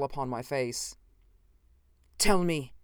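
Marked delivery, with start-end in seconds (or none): 2.2-2.7 loud, higher, commanding; sharp command. A narrator's reading. Strange visitor orders his host, sharply commanding. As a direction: demand an answer sharply. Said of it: "he commanded sharply".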